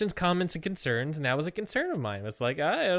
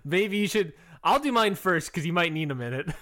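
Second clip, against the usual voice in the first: ordinary delivery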